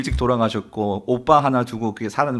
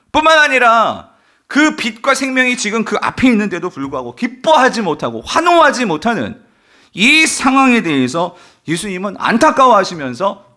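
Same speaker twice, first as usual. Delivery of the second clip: high, loud, driving sermon delivery; full-force preaching; 9 semitones higher, 5 dB louder than usual. Real, not acted. Preacher on Jesus' wrath at death's power, full-force preaching. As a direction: build to an impassioned, sustained cry from the pulpit.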